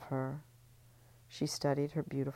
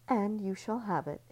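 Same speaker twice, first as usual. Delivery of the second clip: mother; 7 semitones higher than usual. Acted. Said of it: "his mother told him".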